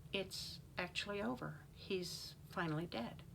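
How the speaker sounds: soft, hushed realization; inward thought. A narrator's reading. Blaine in shock after the killing, hushed thought. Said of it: "he thought".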